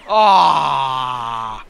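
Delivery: loud, drawn-out falling "aah"; long yell. Real, not acted. Player mid-battle, long loud yell. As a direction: let out a long open yell. Yelling during play.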